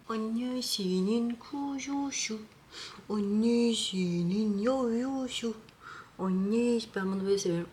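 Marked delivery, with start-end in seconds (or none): 0.1-5.6 slow high sing-song coo. Real, not acted. Cooing to the cat.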